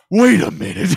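hammed-up gasping double take; mock sudden realization. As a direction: imitate a man stunned as it dawns on him.

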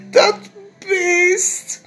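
high loud tearful accusation; tearful boy. Acted. Jip still crying, blaming the insect. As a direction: blame the beast through tears.

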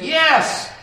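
loud, high yes; high, loud, emphatic yes. Acted. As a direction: proclaim it boldly. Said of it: "He said".

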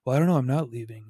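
tossing off a lazy excuse; mimicking the evasive boyfriend; dismissive boyfriend. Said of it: "He's like".

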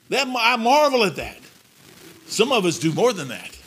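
loud fervent preaching voice; preaching at full force. Acted. Preacher warning his congregation about danger, loud fervent. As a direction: build it up and hammer it home.

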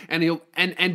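normal talking voice

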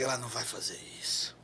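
low disbelieving whisper; whispered aside. Retold or imitated. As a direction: whisper it to yourself, stunned.

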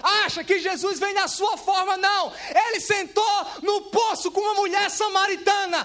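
loud, very high preacher's shout; thundering sermon, pitched high throughout; full-force preaching. Acted. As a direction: belt it out like a fired-up preacher.

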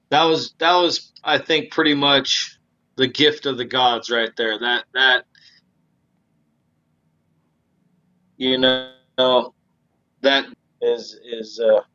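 audio dipping in and out; patchy connection, volume fading; uneven volume. Real, not acted. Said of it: "NorCal internet's fucking with us".

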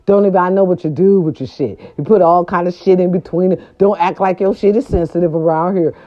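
ordinary talk, nothing marked

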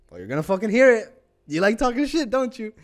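fired-up retelling of squaring up to them; confrontational. Retold or imitated. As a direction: throw it at them, high and forceful. Retold confrontation in the cafe.

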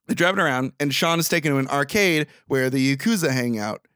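ordinary delivery

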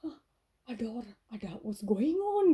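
new worker overhearing fight, bewildered; confused surprise. Retold or imitated. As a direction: sound baffled and caught off guard. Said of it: "saya jadi bingung".

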